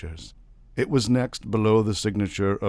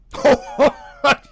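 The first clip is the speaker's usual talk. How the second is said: high, loud scoffing laugh; 12 dB louder than usual. A narrator's reading. Navagin laughing at his wife, loud scoff. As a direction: laugh her off.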